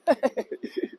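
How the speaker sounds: brief amused chuckle. Real, not acted. Guide amused after singing to Sue, brief chuckle. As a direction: giggle after your own serenade.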